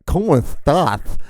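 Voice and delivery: "¿Cómo estás?" drawn out, s turned to "th"; mock Spain lisp. Repeating the Spaniard's greeting in disbelief, heavily lisped. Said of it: "why in the fuck are you talking like that".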